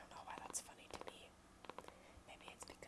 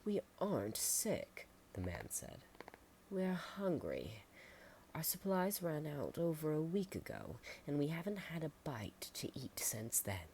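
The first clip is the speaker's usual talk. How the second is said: pleading traveler; 8 semitones higher, 5 dB louder than usual. Acted. Starving visitor explaining to the farmers he is hungry. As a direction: beg them to understand, weak.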